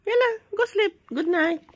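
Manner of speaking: high, loud good-night call; insistent high bedtime call; sending to bed. Real, not acted. Sending the sleeper back to sleep.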